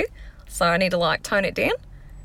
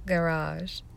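English garage; 6 dB quieter than usual. Shown as a demonstration. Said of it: "in my English pronunciation in my head".

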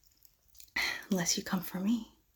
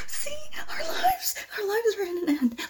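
crying wail; 13 semitones higher than usual. Acted. Hears another gunshot.

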